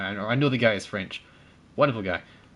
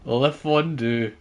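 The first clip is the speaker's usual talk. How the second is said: drawn-out mock French; 4 dB louder, much slower than usual.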